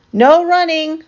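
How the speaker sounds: high, loud reminder; calling after. A narrator's reading. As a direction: call the rule after him down the hall. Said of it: "Miss Merriweather called after him".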